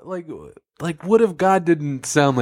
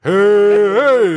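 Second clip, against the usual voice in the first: loud, high God answering; booming God voice; 5 semitones higher, 7 dB louder than usual. Acted. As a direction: boom it out like God.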